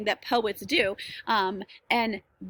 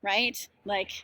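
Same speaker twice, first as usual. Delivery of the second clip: usual speaking voice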